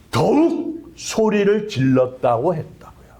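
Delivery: ordinary delivery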